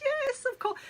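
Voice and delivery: squeaky high tearful yes; crying yes. Retold or imitated. Bride accepting the proposal through tears, high teary yes. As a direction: imitate a weepy, overjoyed yes. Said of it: "she burst into tears and was crying and goes".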